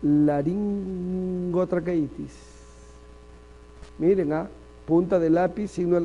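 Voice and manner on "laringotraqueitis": slow spelled-out. Shown as a demonstration.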